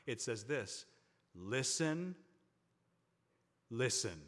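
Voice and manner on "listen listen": "Listen" twice with a long pause between; emphatic repetition. Shown as a demonstration. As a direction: punch each "listen" as its own command. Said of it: "it's really emphasizing this".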